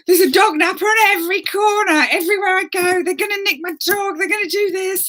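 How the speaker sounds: high-pitched panicky rant; comic catastrophising at a high pitch; mock petrified. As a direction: wail it out in hammed-up terror. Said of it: "absolutely petrified".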